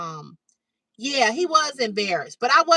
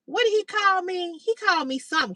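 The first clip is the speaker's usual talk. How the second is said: ordinary talk, nothing marked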